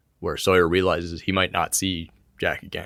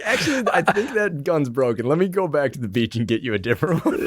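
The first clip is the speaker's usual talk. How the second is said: laughing; 8 semitones higher than usual. Acted. Joking voice for Jack.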